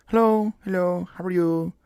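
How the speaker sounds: lightly voiced polite stranger at the door; a hint of an imitated visitor, softening at the end; imitated visitor greeting. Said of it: "not gonna do the accent".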